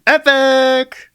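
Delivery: high, loud cheer; excited shout. Acted. Jack cheers the pirate trip, loud high cheer. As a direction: belt it out with glee.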